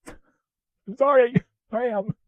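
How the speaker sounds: very high, drawn-out comic apology; mock-sorry squeal. Vlogger apologizing to viewers for botched audio, in a mock high voice.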